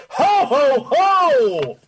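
a big belly laugh like Santa Claus. Acted. Wally back from the snowstorm, jolly Santa laugh. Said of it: "man's voice sounds familiar".

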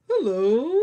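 high puppet greeting; squeaky little bug hello; ladybug voice. Pretend.

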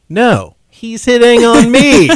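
hammed-up indignant comeback, stretching the words; mock protest. Amanda denies hitting on Brandon, mock protest. Acted defensive quarrel retold.

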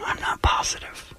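hushed secret-telling whisper; conspiratorial whisper. Pretend. Radio host teasing tomorrow's announcement, whispering. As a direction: whisper it like classified news. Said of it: "Why are you whispering?".

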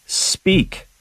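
a sharp high command, suddenly firm; commanding priest. Retold or imitated. Priest confronting a visitor he suspects, commanding him to speak. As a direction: order him to speak at once. Bosco confronts agitated young man.

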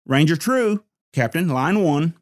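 high, loud Texas holler. A narrator's reading. Secretary hollers to the captain across the office, loud and high. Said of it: "hollered back into Captain's office".

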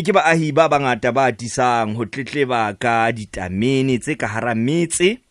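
usual speaking voice

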